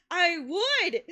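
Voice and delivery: high, loud, gushing imitation; retold eager acceptance. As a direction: act out her keen yes. Acting out her keen acceptance.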